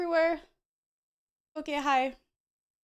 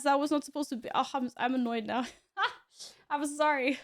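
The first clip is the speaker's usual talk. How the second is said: ordinary talk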